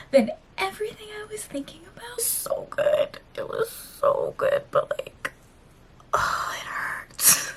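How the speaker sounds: a hushed, crying whisper; tearful whisper. Real, not acted. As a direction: whisper through the tears at one in the morning.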